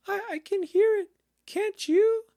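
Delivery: very high, loud stammering squeak; nervous little piglet voice, squealed; anxious Piglet. A narrator's reading. Piglet nearing the trap with Christopher Robin, anxious high squeak. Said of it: "said Piglet anxiously".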